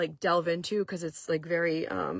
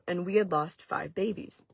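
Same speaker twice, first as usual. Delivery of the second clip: usual speaking voice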